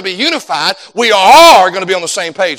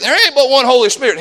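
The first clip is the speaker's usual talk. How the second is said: usual speaking voice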